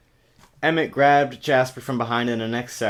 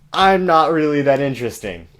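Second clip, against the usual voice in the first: "I'm not really that interesting" louder and higher, stress on "I'm"; mock emphatic reread; 5 semitones higher, 9 dB louder than usual. Shown as a demonstration. Reader repeating the line to mock it, loud emphatic. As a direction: hammer "I'm" for the joke. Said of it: "Boom! Twilight! In one sentence".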